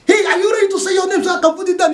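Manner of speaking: high, fast shouted question; impatient call. Acted. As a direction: hurry him up loudly. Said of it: "policeman just ask him".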